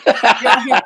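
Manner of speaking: laughing loudly through yeah. Real, not acted. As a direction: burst out laughing, then say yeah. Laughing at his own bad accent.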